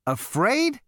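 high, rising exclamation; incredulous cry. A narrator's reading. Zaphod echoing Marvin, incredulous high cry. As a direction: cry it out in disbelief. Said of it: "cried Zaphod".

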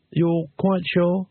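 slow, unsure check; said slowly. Acted. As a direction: draw the question out slowly. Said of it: "he said slowly".

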